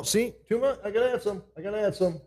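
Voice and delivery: normal talking voice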